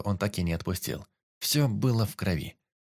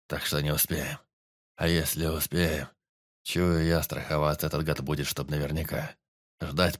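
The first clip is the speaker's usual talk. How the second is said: weak forester; 5 semitones lower than usual. A narrator's reading. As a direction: ask as if barely able to see him. Dying forester explains.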